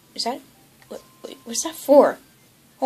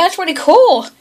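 normal talking voice

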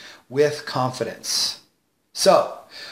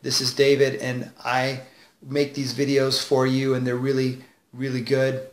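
monotone. Shown as a demonstration. Coach showing viewers an uncharismatic intro, monotone. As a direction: drone it with no rising or falling tones. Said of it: "not just speaking like this".

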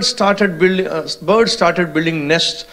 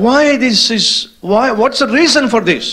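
higher-pitched, acted bewildered plea; urgent questioning; 6 semitones higher, faster than usual. Struggling creatures ask each other the cause of their misery, urgent questioning. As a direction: demand an answer, pitched up high.

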